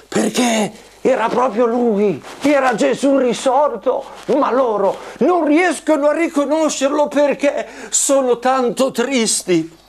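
ordinary delivery, nothing marked

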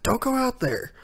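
high-pitched mock alarm; Marco's warning. Reviewer voices Marco warning from the background, mock alarmed.

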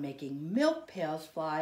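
plain reading voice

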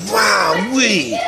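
ordinary talk

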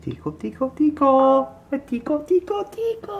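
rising sing-song, climbing very high; playful tickle. Real, not acted. Tickling the baby.

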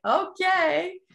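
high, loud, giving in to the brother; a resigned, sing-song okay; reluctant okay. Retold or imitated. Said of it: "And I'm like".

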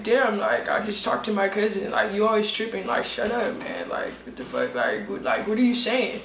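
mumbly put-on voice, mildly fed up; Jerron impersonation. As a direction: imitate an exasperated guy brushing someone off. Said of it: "what's your Jerron impersonation".

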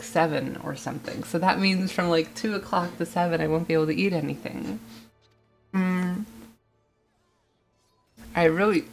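ordinary talk, nothing marked

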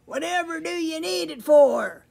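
high, loud goat voice; storybook goat calling out; crying-out goat. A narrator's reading. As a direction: cry out the question as a goat. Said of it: "he cried".

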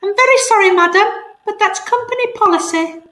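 a prim bird on the phone reciting policy; cockatoo voice. Acted. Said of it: "the cockatoo was saying".

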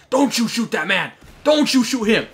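loud, high frantic shout; real yelling. Real, not acted. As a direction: yell it in protest at another player. Yelling at players mid-match.

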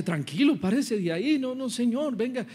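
acted pleading, pitched high; urgent reassurance. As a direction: urge him to calm down and get up, voice climbing. Salesman tries to calm weeping manager. (súplica apurada para calmarlo, voz aguda)

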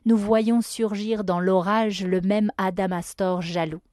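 ordinary delivery

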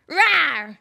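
loud playful monster growl; monster roar. Pretend. Host scaring the viewer again, playful monster roar. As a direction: pounce with a mock roar.

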